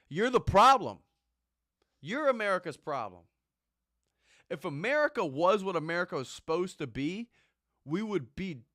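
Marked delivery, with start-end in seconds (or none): 0.1-6.1 loud, high accusing yell; angry shout. Real, not acted. Yelling at the complaining lady, loud and accusing. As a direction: shout it in her face.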